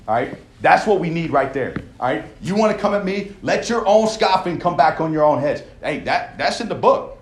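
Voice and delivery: ordinary delivery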